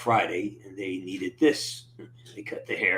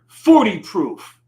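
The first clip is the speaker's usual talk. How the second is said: slow, louder announcer call-out; show-title flourish; 9 semitones higher, 11 dB louder, slower than usual. Acted. Host announcing his stream title to chat, slow and louder. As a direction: stretch it out like a bar sign being read aloud.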